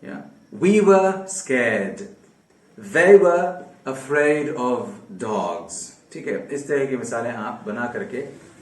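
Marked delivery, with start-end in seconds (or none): 0.0-5.7 each word laid out slowly for learners; slow teacher's example sentence; slow dictation. Shown as a demonstration. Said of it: "उसी हिसाब से आप".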